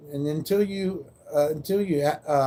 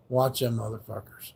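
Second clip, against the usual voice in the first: low warning; 5 semitones lower, 7 dB quieter than usual. Retold or imitated. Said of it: "It's like".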